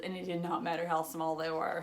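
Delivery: usual speaking voice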